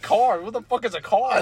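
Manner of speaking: incredulous future person. Pretend. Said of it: "They're like".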